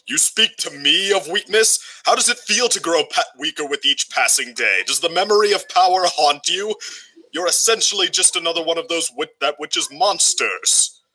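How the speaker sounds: Lotor taunting. Retold or imitated. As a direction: sneer it down at a fading tyrant. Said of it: "Lotor in that battle with the".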